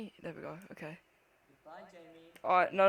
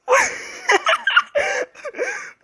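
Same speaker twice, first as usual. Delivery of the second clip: shrieking, nervous giggle; high nervous laugh; 6 dB louder than usual. Real, not acted. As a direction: laugh off the scare. Laughs after scare.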